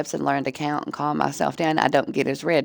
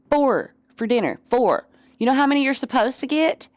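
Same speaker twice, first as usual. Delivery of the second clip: higher, teasing lecture to the cat; playful scolding; 7 semitones higher than usual. Real, not acted. Lecturing the cat about four portions, higher teasing scold. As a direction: count his portions at him, mock outraged.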